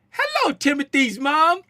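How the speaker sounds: high mock-British greeting; full British accent. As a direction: put on a very proper British hello. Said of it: "I just went full British".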